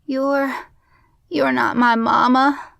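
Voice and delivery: put-on high, hesitant small-child voice; tearful little boy. A narrator's reading. Little boy realising she isn't his mother, tearful. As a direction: falter like a child about to cry. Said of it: "the little boy said softly, his lower lip quivering".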